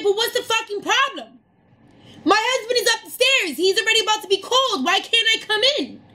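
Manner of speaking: high-pitched, indignant demand without raising the volume; pissed-off protest. Retold or imitated. Diner shut out from her husband's table, pissed-off protest. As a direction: argue your case in a high, exasperated voice. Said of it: "pissed, you know what I mean?".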